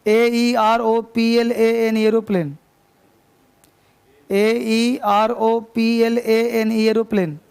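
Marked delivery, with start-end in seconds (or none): 0.1-2.0 slow letter-by-letter dictation; teacher dictating letters; spelling out. Shown as a demonstration. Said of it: "teacher spelling out for dictation". 4.3-6.7 slow letter-by-letter dictation; teacher dictating letters; spelling out. Shown as a demonstration. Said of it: "teacher spelling out for dictation".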